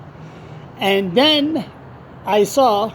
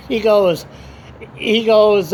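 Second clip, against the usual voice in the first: usual speaking voice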